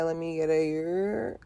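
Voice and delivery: ordinary talk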